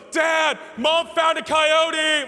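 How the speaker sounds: loud, high, drawn-out mock kid's shout; excited kid yelling. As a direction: imitate a son hollering gleeful news to his dad. Imitates son excitedly reporting to dad.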